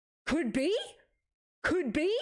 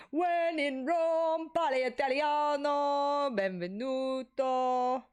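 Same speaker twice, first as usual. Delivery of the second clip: mock Italian accent; 5 semitones higher, much slower than usual. Mock Italian welcome in Rome.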